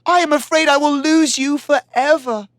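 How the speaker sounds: high, pleading fear for her love; afraid. Acted. Iztaccíhuatl begging Popocatépetl not to leave, afraid. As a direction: beg him not to go, frightened. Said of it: "she said".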